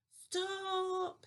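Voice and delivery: a truck squealing in alarm. A narrator's reading. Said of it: "squealed the bu".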